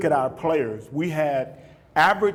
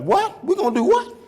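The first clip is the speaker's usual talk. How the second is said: very high mock disbelief; incredulous player; 4 dB louder than usual. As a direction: balk at the coach's plan. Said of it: "But you got guys".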